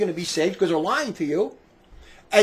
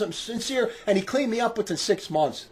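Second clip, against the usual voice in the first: normal talking voice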